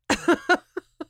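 high laugh. Real, not acted. Embarrassed laugh after the story.

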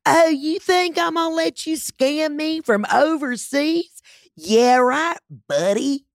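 high, slow, snotty sarcasm. Retold or imitated. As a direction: lay on the entitled sneer.

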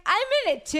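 high-pitched, dorky eagerness; mock excited. Woman mocking herself jumping into a fan photo, excited. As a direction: act over-excited, pitch way up. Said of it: "get all excited".

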